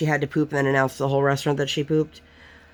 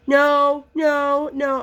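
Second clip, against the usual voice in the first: put-on refusing child; 11 semitones higher, much slower than usual. Daughter refusing to wait, mock bratty child. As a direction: mimic a toddler saying no.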